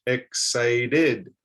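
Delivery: "excited" drawn out as EX-CI-DID; "excited" with a separate "id" ending; three distinct syllables. Shown as a demonstration. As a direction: say "excited" slowly, beat by beat. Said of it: "a three-syllable word".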